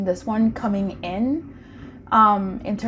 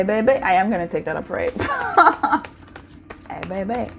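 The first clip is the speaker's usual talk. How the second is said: ordinary talk, nothing marked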